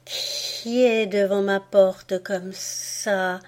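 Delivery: slow, drawn-out put-on voice of the snake; a lightly voiced wary snake; snake mother voice. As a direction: stretch it slow and wary from her hole. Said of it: "et elle dit".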